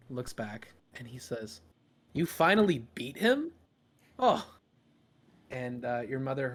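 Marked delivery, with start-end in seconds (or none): 2.1-4.4 higher, louder impressed dad; amused surprise; surprised father. Acted.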